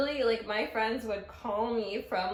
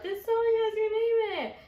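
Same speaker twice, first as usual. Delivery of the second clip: put-on drunk friends shouting; 11 semitones higher, 4 dB louder than usual. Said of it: "so many of my friends were like".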